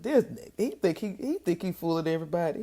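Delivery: mocking the tubby one. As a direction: play the smug little guy who thinks he fools everyone. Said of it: "he's like".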